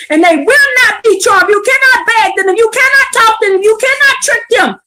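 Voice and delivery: preacher driving home each "you cannot"; preaching climax. Real, not acted. Preacher warning the congregation, preaching climax, rising high.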